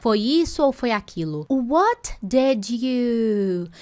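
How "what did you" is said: very slow, linked words drawn out; slow demo. Shown as a demonstration. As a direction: draw out "What did you" as one slow chunk. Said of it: "pronúncia". ("What did you" muito lento e ligado)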